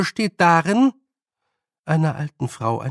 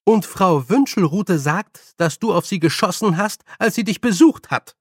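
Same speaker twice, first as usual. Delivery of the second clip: raised, higher reproach; stern scolding; 5 semitones higher, 4 dB louder than usual. A narrator's reading. Nanny adds another complaint about shooting, stern. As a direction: keep up a firm, emphatic telling-off. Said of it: "sagte Nanny Ogg streng".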